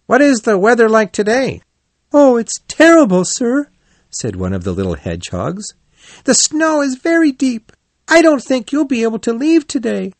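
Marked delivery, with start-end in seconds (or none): 0.1-1.6 high, lively question; cheerful Rat character. Acted. Rat asks the hedgehogs, voiced high. 2.1-3.7 very high, loud little voice; young hedgehog. Acted. Young hedgehog reports to elders. 6.2-10.1 very high, earnest little voice; young hedgehog. Acted. Young hedgehog reports on snow.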